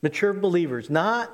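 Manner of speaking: ordinary talk, nothing marked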